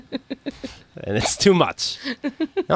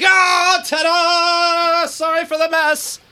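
very high, sing-song mock announcement; hammed-up showy climax; 13 semitones higher, much slower than usual. Host jokes about his own noises in bed, hammed-up ta-da. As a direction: sing it out high like a joke finale. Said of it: "I think I'm like".

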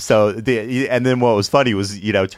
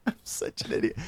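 whisper; 11 dB quieter than usual. Retold or imitated. Self-reproach in retold panic.

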